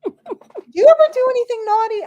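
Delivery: high, loud teasing question; playful tease. Real, not acted. Teasing her friend about mischief, high playful tease. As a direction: needle her with glee.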